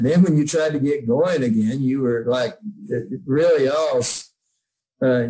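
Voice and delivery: usual speaking voice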